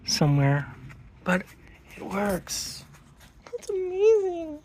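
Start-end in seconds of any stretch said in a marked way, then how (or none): 3.4-4.6 amazed, pitched much higher; thrilled. Real, not acted. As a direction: burst out in delighted disbelief. Thrilled engine runs.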